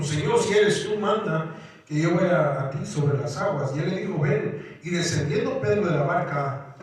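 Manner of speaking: reading the passage aloud with a lift; preacher reads the storm passage to the church, lifted reading voice; scripture reading. Acted.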